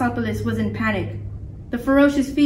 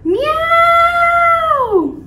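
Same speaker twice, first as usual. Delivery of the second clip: very high, loud, long meow; drawn-out falsetto cat screech; falling cat yowl; 6 dB louder than usual. A narrator's reading. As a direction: wail like a cat plummeting. Giant cat falls.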